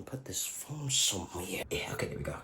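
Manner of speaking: whispered aside; whispering. Real, not acted. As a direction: whisper it to yourself. Fiddling with phone aside.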